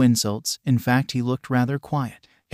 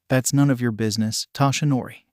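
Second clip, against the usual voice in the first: usual speaking voice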